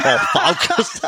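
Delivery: high, loud hammed-up Kiwi accent; New Zealand accent. Shown as a demonstration. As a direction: push the Kiwi accent on our focus. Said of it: "it's a bit more".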